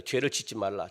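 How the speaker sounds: God's warning. Retold or imitated. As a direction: speak down gravely as God. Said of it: "자꾸 말하잖아요". (낮고 엄숙한 하나님의 경고)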